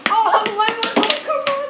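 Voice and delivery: high, loud urging; excited cheering. Real, not acted. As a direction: cheer the team on, pitch up. Cheering on the game.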